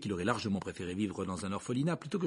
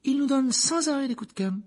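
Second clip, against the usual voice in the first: Harry's voice; 6 dB louder than usual. Acted. Harry playing along.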